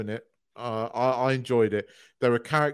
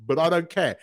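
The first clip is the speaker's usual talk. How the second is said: usual speaking voice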